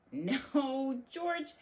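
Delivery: high, long "no"; dismayed correction. Acted. Mother correcting her quacking dog, high dismay.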